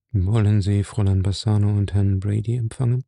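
usual speaking voice

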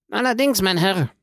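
high, emphatic reply in character; said aloud. A narrator's reading. Hungry prisoner answering his guard, pointedly speaking up. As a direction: speak up pointedly, pitched well above narration. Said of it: "fügte er laut hinzu".

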